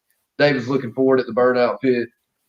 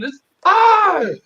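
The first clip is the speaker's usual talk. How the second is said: very high retold scream; mock scream; 3 dB louder than usual. Bystanders screaming as the burning car passes, mock scream. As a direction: shriek it high like an alarmed crowd. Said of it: "they start screaming".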